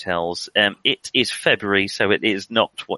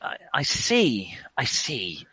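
stunned whisper; 4 dB quieter, slower than usual. Acted. Stationmaster whispers in shock.

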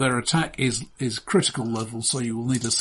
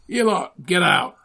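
loud, higher barked order; thug shout; 7 semitones higher, 5 dB louder than usual. Acted. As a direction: bark at the patrons to leave. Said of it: "they shout at the".

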